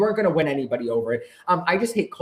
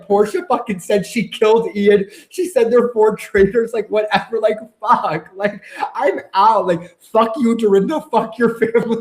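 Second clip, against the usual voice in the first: heated rant; 4 semitones higher, 5 dB louder than usual. Retold or imitated. Heated rant voicing Ron's frustration.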